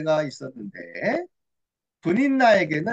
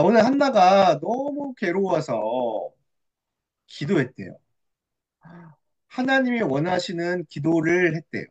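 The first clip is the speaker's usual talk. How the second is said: ordinary delivery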